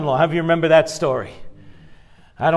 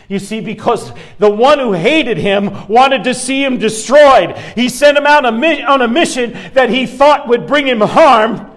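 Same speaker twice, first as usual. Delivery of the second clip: rising, louder preacher's build; preaching; 5 semitones higher, 5 dB louder than usual. Acted. Pastor building to the congregation, preaching louder. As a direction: drive the point home to the congregation.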